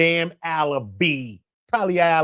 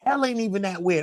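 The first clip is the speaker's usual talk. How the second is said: usual speaking voice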